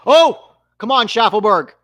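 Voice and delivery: real fan frustration bursting out; frustrated cry. Real, not acted. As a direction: plead loudly with the player. Groaning at a lost chance.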